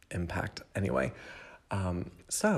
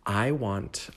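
ordinary talk, nothing marked

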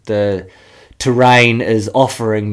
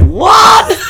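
much higher shocked shout; 5 dB louder than usual. Retold or imitated. Boys hearing the dynamite offer, a high shocked shout. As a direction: blurt it in total disbelief.

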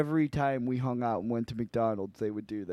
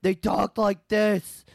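high, loud, drawn-out impression; mock swollen-tonsil twin voice; tonsil-trouble voice; 7 semitones higher, 5 dB louder, slower than usual. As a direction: imitate someone with messed-up tonsils. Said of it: "their tonsils were fucked up".

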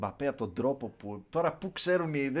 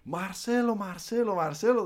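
higher, repeated mock calling of the name; imitating fan's calls; 7 semitones higher, 4 dB louder, slower than usual. Brazilian fan trying to get his idol's attention, imitated calls. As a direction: mimic a fan lightly calling out over and over. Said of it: "να φωνάζει".

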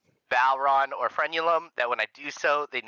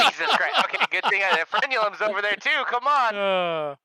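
high, loud exasperated groaning; Jesus Christ, come on, higher up; exasperated outburst; 10 semitones higher, 4 dB louder than usual. Real, not acted. Teammate fails the check.

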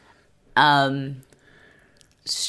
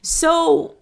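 much higher, incredulous asking; confused question; 14 semitones higher, 3 dB louder than usual. Retold or imitated. Asking the show about Damien's friends, incredulous and much higher. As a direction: puzzle it out with exaggerated disbelief. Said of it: "And I'm like".

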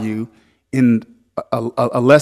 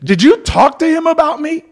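high, startled voice of a friend; surprised friend; 3 dB louder than usual. Retold or imitated. Friend texting back in amazement, high surprised squeak. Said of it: "hit their friend back and say".

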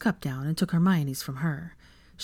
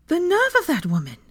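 high-pitched indignation; affronted exclamation; huffy; 12 semitones higher, 4 dB louder than usual. A narrator's reading. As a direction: huff in outrage, voice shooting up. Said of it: "Hermione huffed".

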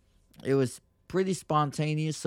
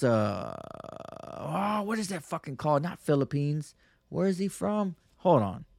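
usual speaking voice

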